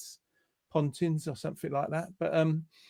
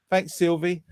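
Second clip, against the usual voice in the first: usual speaking voice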